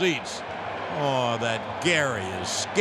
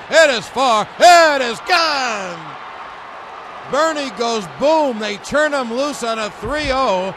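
much louder, building shout; home run shout; 7 semitones higher, 6 dB louder than usual. Acted. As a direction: roar the call over the crowd.

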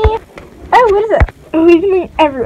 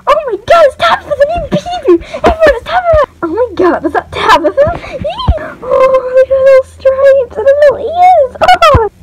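excited squealing; 9 semitones higher, 3 dB louder than usual. Acted. Toys greet the new star.

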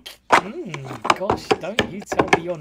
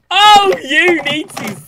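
loud, high, drawn-out cry; overexcited protest; 10 semitones higher, 16 dB louder, slower than usual. Real, not acted. Reactor begs the restocker to stop, overexcited loud cry. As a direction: wail it slowly at full volume.

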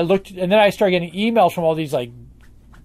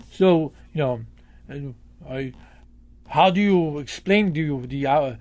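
imitating a German fan's thick German accent. Retelling a German fan's question in a thick German accent. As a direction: quote him in a heavy German accent. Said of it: "in very thick German accent".

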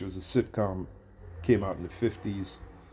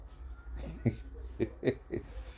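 soft chuckle; 3 semitones higher, 8 dB quieter than usual. Real, not acted. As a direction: chuckle to yourself under your breath. Laughing at the absurd claim.

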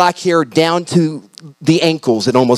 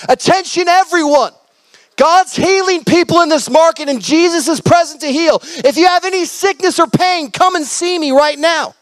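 calling out to the market; 11 semitones higher than usual. Retold or imitated. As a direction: announce it like a town crier over the crowd.

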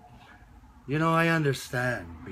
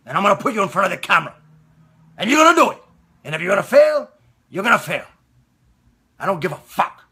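loud, high forceful shouting; father's tough talk; 9 semitones higher, 13 dB louder, much faster than usual. Acted. Father ordering his shy son to act, forceful shout. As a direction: drive each order home at full voice. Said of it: "I would take him and I would say".

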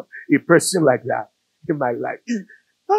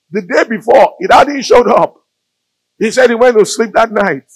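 building urgency; 6 semitones higher, 7 dB louder than usual. Acted. Sermon build, deadline approaching.

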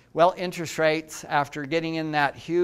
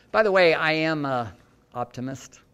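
ordinary talk, nothing marked

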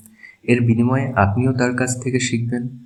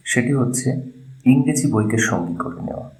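ordinary talk

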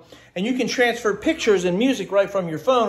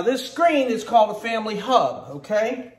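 ordinary delivery

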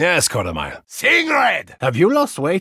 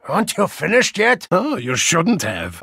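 ordinary talk

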